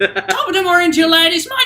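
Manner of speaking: very high, loud squeaky Irish greeting; hammed-up Irish accent, squeaky and loud; crappy Mickey Mouse. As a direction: squeak it out like a drunk cartoon mouse. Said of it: "the really crappy Mickey Mouse voice".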